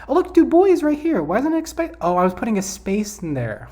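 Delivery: high, loud outburst of disbelief; surprised realization. Real, not acted. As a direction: exclaim as you suddenly spot your own mistake. Spots own mistake with surprise.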